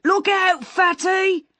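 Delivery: shouted. Acted. Taxi driver yells at Miss Moss crossing, a high loud shout. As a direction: bellow it across the road at her. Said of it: "shouted a taxi driver".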